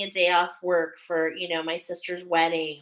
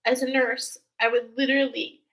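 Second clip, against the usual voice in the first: ordinary delivery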